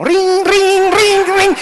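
high, loud ring ring like a phone; phone ringing imitation. Preacher imitating his mother's call ringing, high and loud. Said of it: "sonaba el teléfono".

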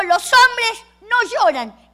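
put-on a touch of a stern grown-up scolding a boy; stern saying. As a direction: lay down the rule like a stern elder. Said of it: "alguien le dijo".

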